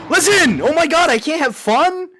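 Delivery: high, very loud indignant outburst; loud protest. Real, not acted. Streamer snapping back at chat, loudly indignant. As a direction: yell it in exasperation.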